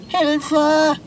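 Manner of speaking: high-pitched wail for help; calling for help. Acted. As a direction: cry out for rescue. Stuck cat calls for help.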